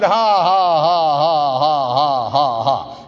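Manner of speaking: even "ha ha" run sliding down in pitch; forced laugh. Retold or imitated. Man answers devil's taunt, forced descending laugh. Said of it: "I just kept laughing".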